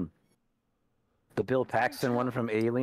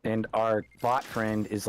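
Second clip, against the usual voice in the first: normal talking voice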